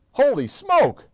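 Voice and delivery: loud high amazed cry; shocked exclamation. A narrator's reading. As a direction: blurt it out in shock. Brother shocked by transformation.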